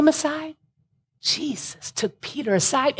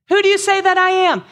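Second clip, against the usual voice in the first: loud; 8 semitones higher, 6 dB louder than usual. Acted. Reads Jesus' question twice.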